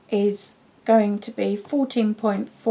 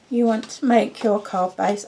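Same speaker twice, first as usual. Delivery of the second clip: ordinary talk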